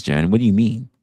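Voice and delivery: ordinary delivery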